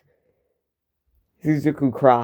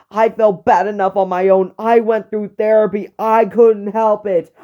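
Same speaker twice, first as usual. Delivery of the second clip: furious yell; 7 semitones higher than usual. Acted. Izuku yelling at Bako about the blame, furious. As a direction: hurl every sentence at him. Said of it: "As Izuku yells".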